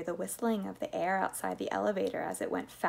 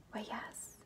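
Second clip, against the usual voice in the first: tiny hushed whisper; very soft, breath-only reply; 3 semitones higher, 12 dB quieter than usual. A narrator's reading. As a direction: whisper it shyly. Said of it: "whispered Charlie".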